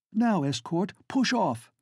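high urgent command; exclaiming. Acted. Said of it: "exclaims the former".

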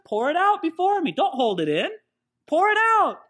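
urgent plea. Acted. God voiced urging release.

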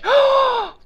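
sharp high gasp of delight; gasp, thrilled by the idea; excited gasp. Acted. Puppet gasps at learning Spanish words, delighted gasp.

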